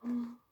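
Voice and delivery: quick startled gasp; dramatic storyteller gasp; sharp gasp. A narrator's reading. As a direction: gasp in alarm. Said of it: "narrator gasps".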